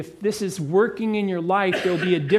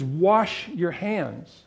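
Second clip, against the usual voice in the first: scripture quote; much slower than usual. Shown as a demonstration. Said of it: "Then he says".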